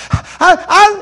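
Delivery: high, loud bawling stammer; imitated sobbing start to a sentence; mock crying. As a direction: blubber loudly on a high pitch. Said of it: "here's what he's crying".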